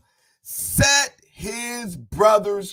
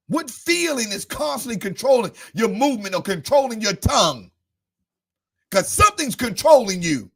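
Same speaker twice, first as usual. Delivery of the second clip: higher, louder shouted sermon with stretched words; full-force preaching; 6 semitones higher, 4 dB louder than usual. Acted. Preacher pressing the church about feelings, shouted preaching. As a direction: hammer it home like a fiery preacher.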